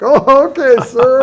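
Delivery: high, loud, half-laughing surprise; startled laughing okay. Retold or imitated. Aide blurting to his boss after a surprise order, high and laughing. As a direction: caught off guard, blurt it out with a laugh.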